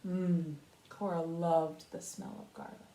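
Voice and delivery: ordinary delivery, nothing marked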